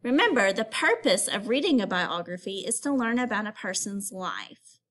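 usual speaking voice

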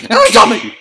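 loud, high call of a name; panicked shout. Real, not acted. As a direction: yell the name in alarm. Calling out in panic.

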